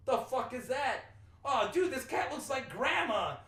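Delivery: high, loud mock astonishment; imitated Brooklyn exclamation. Imitating a man who finds a weird cat at his door, astonished shout. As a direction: exclaim it like a startled Brooklyn guy. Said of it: "got this thick, like, Brooklyn accent".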